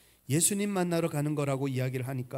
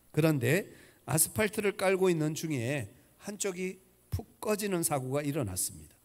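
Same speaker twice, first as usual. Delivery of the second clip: ordinary talk, nothing marked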